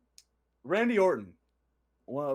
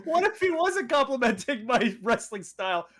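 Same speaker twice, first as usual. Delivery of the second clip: higher, louder, laughing through it; 9 semitones higher, 5 dB louder than usual. Real, not acted. Joking about misreading Randy's compliment, laughing. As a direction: giggle out the self-doubt.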